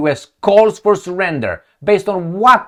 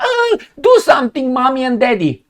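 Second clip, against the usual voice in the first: mock whiny helpless citizen; 9 semitones higher than usual. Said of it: "then you're gonna see them".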